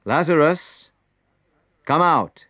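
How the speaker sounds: high, loud command into the cave; calling loudly. A narrator's reading. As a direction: call the dead man out. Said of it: "Then Jesus called loudly".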